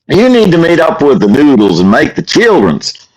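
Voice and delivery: high mock Southern exhortation; fake Southern accent. Pastor re-enacting his trolling, fake Southern accent. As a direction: urge it in a put-on, swooping Southern accent. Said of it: "I had my fake Southern accent".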